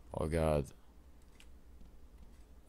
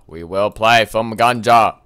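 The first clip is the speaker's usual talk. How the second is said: louder, punching the word play; proclaiming; 2 semitones higher, 9 dB louder than usual. Acted. Gamer announcing the game to viewers, loud proclamation. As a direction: announce it like a herald.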